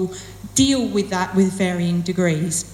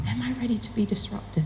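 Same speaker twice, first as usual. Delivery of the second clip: near-whispered question to oneself; softly voiced inner doubt; tentative self-questioning; 8 dB quieter than usual. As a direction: drop to a hushed, wondering whisper. Retold hesitant thought after relenting.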